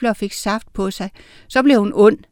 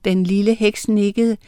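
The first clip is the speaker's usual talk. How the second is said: ordinary delivery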